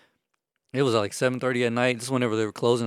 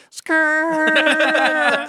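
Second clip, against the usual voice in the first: high, loud drawn-out skid noise; tire screech imitation; 7 dB louder than usual. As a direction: screech like spinning tires. Imitates skidding tires.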